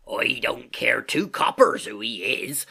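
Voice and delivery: loud, drawn-out sailor voice; Silver crying out. A narrator's reading. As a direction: call out slowly as a gruff landlord. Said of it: "cried Silver".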